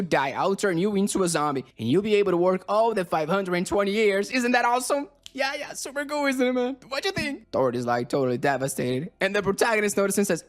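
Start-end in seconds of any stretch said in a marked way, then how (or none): 4.3-7.5 high, giddy mock enthusiasm; gleeful pitch. Friend sells his zombie plan, gleeful. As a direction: squeal it eagerly, pitched way up.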